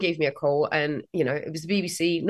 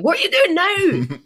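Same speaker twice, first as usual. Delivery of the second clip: much higher, slower imitation of a baffled father; mock the dad catching his kid dancing; puzzled dad; 11 semitones higher, 4 dB louder than usual. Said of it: "my dad was like".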